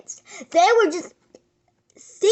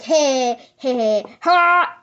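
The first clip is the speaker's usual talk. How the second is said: goofy; 3 semitones lower, 4 dB louder, much slower than usual. Real, not acted. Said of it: "I'm in a silly, goofy mood".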